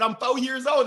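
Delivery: put-on loud, high child's answer; hammed-up young boy, loud and high; insistent little kid. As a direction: pipe up like a small child. Child repeating answer loudly.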